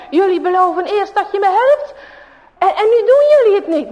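high, loud and fast complaint; storyteller's angry bully. A narrator's reading. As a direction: rattle off the grievance in anger. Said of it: "rood van kwaadheid".

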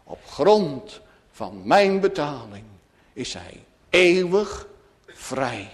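ordinary talk, nothing marked

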